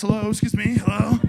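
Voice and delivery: high, loud mock hail; calling out. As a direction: call for attention at someone's door. Imagines calling at a stranger's house.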